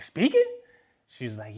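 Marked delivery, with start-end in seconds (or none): 0.0-0.4 high squeaky disbelief; surprised. Retold or imitated. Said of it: "I was like".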